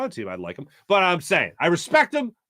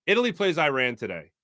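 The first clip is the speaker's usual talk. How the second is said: normal talking voice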